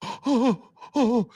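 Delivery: high mock whimper, lightly done. Mocking the heckler with tears in his eyes, high whimper. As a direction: imitate him near tears, whining. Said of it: "He's like".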